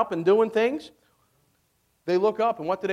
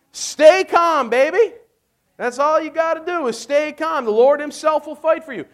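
fervent preaching; 6 semitones higher, 4 dB louder than usual. Acted. Preacher urging congregation.

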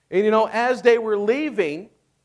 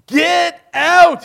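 slow, loud, higher command; ordered; 10 semitones higher, 10 dB louder than usual. A narrator's reading. Pharaoh ordering Moses out at night, loud slow command. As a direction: bark it out as a royal order. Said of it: "he ordered".

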